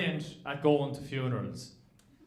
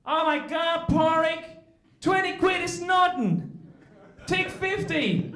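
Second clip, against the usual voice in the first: put-on American accent; 11 semitones higher, slower than usual. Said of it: "and I go".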